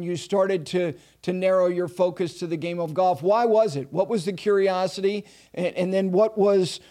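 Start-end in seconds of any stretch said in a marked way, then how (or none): none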